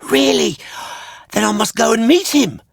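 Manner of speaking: very high, loud eager shout; excited cry. Acted. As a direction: burst out with joy. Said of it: "cried Pinocchio".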